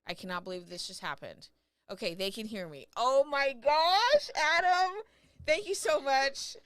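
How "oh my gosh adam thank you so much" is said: high, loud, thrilled shriek of joy; can't contain the joy; ecstatic outburst. Real, not acted. Streamer hearing the verdict, overjoyed and loud.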